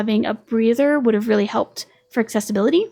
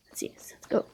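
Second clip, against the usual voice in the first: whisper; 12 dB quieter than usual. Real, not acted. Quiet aside to herself.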